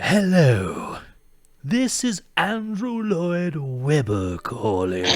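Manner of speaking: high, loud and slow posh-sounding greeting; Andrew Lloyd Webber voice. Retold or imitated. Andrew Lloyd Webber calling the office, grand slow voice. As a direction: announce yourself grandly, drawn out. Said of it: "it's just how he talks".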